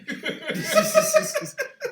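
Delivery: shrieking burst of laughter; loud high laugh. Real, not acted. Big laugh.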